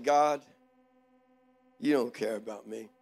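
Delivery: low, soft, dejected complaint; retold prayer, quietly wounded; tearful hurt. Overlooked man complaining to God, tearful hurt. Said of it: "I started crying".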